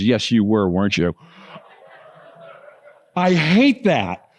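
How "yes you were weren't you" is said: low-pitched, knowing retort; knowing mom. Retold or imitated. Mom answering her son's smoking confession, low and knowing. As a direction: imitate a mother who sees right through it.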